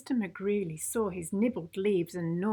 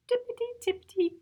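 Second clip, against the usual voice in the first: ordinary delivery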